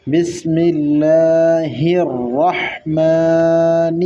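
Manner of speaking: slow بسم اللہ الرحمن with ح flowing straight into ر; joined ha and ra. Shown as a demonstration. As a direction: recite الرحمن joining ح to a heavy ر. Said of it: "را کے ساتھ ملانا ہے". (ح کو را سے ملا کر)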